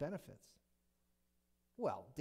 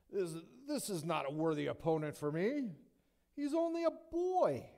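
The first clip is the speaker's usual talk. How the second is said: put-on higher, louder scornful voice, slowing on the boy; arrogant giant; 5 semitones higher, 6 dB louder than usual. Goliath scorning the boy David, arrogant and louder. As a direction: sneer it down at him. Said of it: "You can hear the arrogance".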